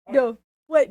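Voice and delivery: ordinary delivery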